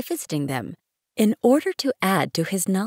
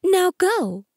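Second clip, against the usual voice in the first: higher, proud dismissal; proud flower; 8 semitones higher, 5 dB louder than usual. A narrator's reading. Proud flower sending the prince away, proudly dismissive. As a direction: send him off with raised pitch and pride.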